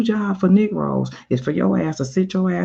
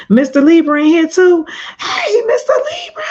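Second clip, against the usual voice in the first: much higher, loud delighted greeting; a loud, high happy hello to a viewer; excited shout-out; 8 dB louder than usual. Real, not acted. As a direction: call out to a friend who just showed up. Spots a viewer in chat.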